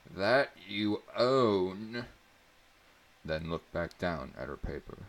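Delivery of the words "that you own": slow, louder stress on "That you own"; emphasized. A narrator's reading. Teacher stressing to the class "That you own", slow and louder. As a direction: lean hard on "That you own", slowly. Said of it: "looked up and emphasized".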